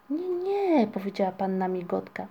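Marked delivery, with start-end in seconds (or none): 0.1-0.9 high refusal. A narrator's reading. Migotka refuses to fetch mother.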